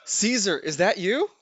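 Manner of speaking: loud, high cry of recognition; anime shout. Pretend. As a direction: call out like a grieving anime hero.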